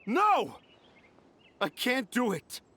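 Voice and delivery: anguished refusal. Acted. Author refusing to help the carvers, anguished. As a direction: burst out, then sink.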